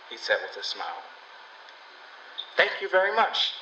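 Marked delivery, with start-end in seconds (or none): 2.6-3.6 polite. A narrator's reading. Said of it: "the girl said politely".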